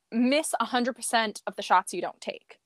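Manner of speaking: ordinary talk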